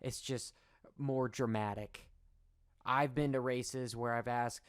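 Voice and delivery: ordinary talk, nothing marked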